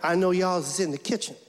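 hammed-up eighties rapper, hesitant and apologetic. Guest walking into the kitchen to ask about dinner, eighties rapper voice. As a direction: play a sheepish eighties rapper caricature.

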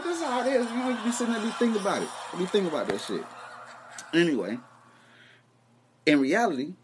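normal talking voice

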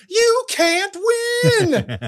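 very high, drawn-out mock yell; Adrian. Comic as Adrian warning Rocky, high hammed-up yell. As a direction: stretch it into a piercing movie cry. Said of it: "she's yelling".

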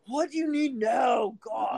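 loud, drawn-out exasperated demand; bitchy snapping. Acted. Imitating oneself as the bitchy one, exasperated. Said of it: "I'm always the bitch that's like".

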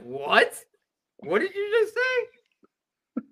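high-pitched, stretched-out disbelief; incredulous squeak of a question; taken aback. Retold or imitated. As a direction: retell a startled double take, voice shooting up. Acts out his startled reply.

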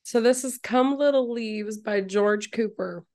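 ordinary talk

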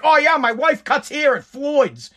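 mock husband using his wife's name for a freebie; entitled mooch. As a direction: play a smug guy angling for a freebie. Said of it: "I would never be like".

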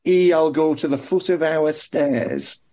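put-on slow old Lancashire accent; broad northern, drawn out slowly. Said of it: "That's classic [name]".

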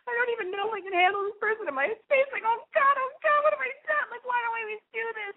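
sangat tinggi, cepat, panik yang dibuat-buat; mock panic. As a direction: tirukan diri sendiri yang panik, nada melengking tinggi. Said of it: "I was, like, really freaking out".

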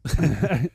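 a quick burst of real laughter; short laugh. Real, not acted. Laughing at the Tony Robbins jab, quick real laugh. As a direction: laugh off the teasing.

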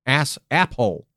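slow, tongue held down; holding tongue. Pretend. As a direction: say it gripping your tongue. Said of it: "holding your tongue and saying".

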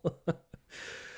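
quiet unvoiced chuckle; a breathy laugh at himself; soft breathy laugh. Real, not acted. Laughs at his own rant.

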